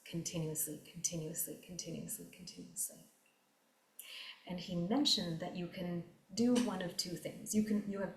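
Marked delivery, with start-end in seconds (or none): 0.1-3.0 each continuously softer until it dies to a whisper; fading repetition. Acted. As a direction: let the word fade away, ending in a whisper. Repeating word, fading to nothing.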